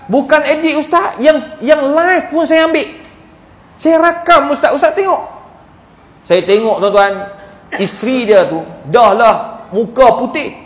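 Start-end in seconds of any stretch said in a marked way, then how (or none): 0.1-5.3 retold earnest insistence, higher pitched; high, urgent-to-convince retelling; insistent. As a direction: protest that it is genuine, voice raised in pitch. Acts widower insisting photo is genuine.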